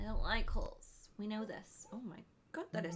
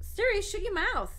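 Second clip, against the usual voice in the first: much higher and louder, snapping at the phone; angry yell; 11 semitones higher, 8 dB louder than usual. Real, not acted. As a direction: shout Siri down in annoyance. Yelling at phone assistant.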